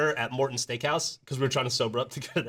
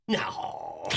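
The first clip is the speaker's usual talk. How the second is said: deep, flat disbelief; a low, doubting no; disbelieving no; 12 semitones lower, 5 dB quieter than usual. Retold or imitated. Fan's disbelieving reply, retold.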